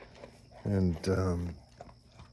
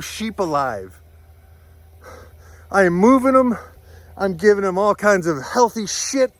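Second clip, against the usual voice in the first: angry; 9 semitones higher, 5 dB louder than usual. Real, not acted. Angry rant about dying sheep.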